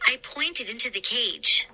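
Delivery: usual speaking voice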